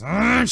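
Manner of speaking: high, loud roar; acted cry of rage as she charges; sudden high-pitched attack yell; battle cry. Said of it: "and she goes".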